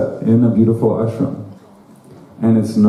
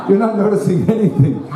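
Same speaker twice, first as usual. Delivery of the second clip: higher, breathy laugh into the words; 8 semitones higher than usual. Real, not acted. Laughing at the wife's scolding, amused. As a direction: laugh through the line.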